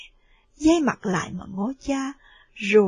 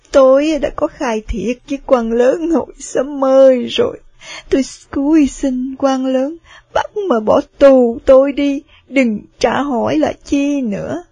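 loud desperate plea; 2 semitones higher, 7 dB louder than usual. Acted. Wife begs to be jailed.

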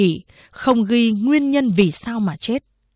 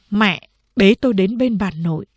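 usual speaking voice

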